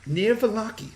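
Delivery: put-on haughty child, pitched high; ostentatious young girl. Girl telling rescuers where she lives, high and haughty. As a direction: answer like a superior little girl. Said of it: "most ostentatious, uh, ten-year-old girl".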